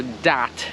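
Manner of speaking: "dat" with a hard d for th; mock wrong th. Shown as a demonstration. Teacher imitating a learner's mistake, mock wrong. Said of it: "they are saying".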